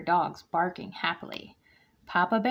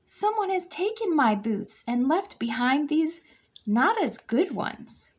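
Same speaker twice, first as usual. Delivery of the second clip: wailing; 8 semitones higher than usual. A narrator's reading. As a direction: wail it like an upset cub. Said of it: "she wailed".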